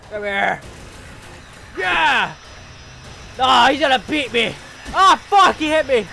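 loud high panicked fight shouts; frantic yelling. Real, not acted. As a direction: yell through the fight.

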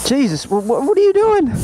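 high-pitched, stammered self-scolding; exasperated outburst. Real, not acted. Rider scolding himself at the tire pump, exasperated.

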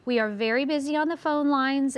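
normal talking voice

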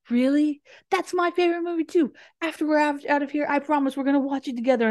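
high, gushing imitation of eager bonding; mock enthusiasm. Operator bonding with kidnapped caller, mock enthusiasm.

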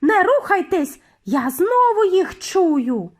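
loud, high shouted warning; high cry, slowing at the end; Mildred. A narrator's reading. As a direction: shout to freeze the whole hall. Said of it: "крикнула Мілдред".

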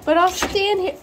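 loud, high playful teasing. Real, not acted. Loud playful talk to pet.

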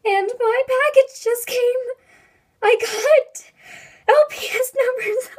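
high, loud excited squeal; bursting with excitement, pitch way up. Real, not acted. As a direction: squeal with real excitement about the package. Excited package arrival squeal.

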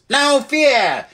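very high, squawked exclamation; perky loud jackdaw. Acted. Jackdaw blurting into silence, loud perky squawk. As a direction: pipe it out like a cheeky bird. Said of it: "added in a loud voice".

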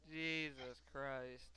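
very slow, drawn-out exclamation; exasperated dismay. Real, not acted. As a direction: stretch each word out in weary disbelief. Dismayed after losing race.

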